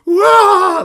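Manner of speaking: piercing high scream from the attic; distant scream. Acted. Banshee screaming somewhere up in the attic. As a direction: shriek high and loud, far off upstairs. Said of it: "There's, like, screaming".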